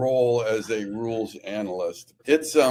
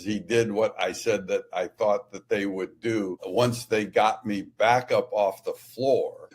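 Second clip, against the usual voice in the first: usual speaking voice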